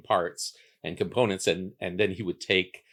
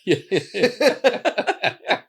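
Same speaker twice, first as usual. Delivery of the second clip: bursting into laughter on the yeah; high, loud laughing yeah; 10 dB louder than usual. Real, not acted. Guest laughing at the host's joke, with a big burst of laughter. Laughs at pig slaughter image.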